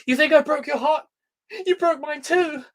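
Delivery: high, strained mock-melodrama; overwrought drama quote. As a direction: squeal it out like bad melodrama. Mock-quotes cringe show line.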